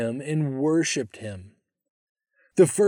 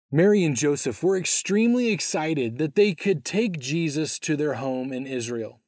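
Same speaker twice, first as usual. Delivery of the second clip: plain reading voice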